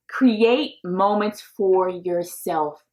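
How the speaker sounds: very slow, every word weighed; slow, deliberate advice; slow emphasis. Shown as a demonstration. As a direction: lay it out slowly so it sinks in. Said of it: "Listen to me".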